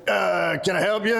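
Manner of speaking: mock supplement-shop guy accent; Chet accent. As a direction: put on a mild caricature accent for the shop salesman. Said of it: "have to talk in this accent when I do Chet".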